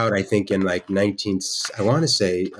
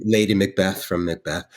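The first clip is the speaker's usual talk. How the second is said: ordinary talk, nothing marked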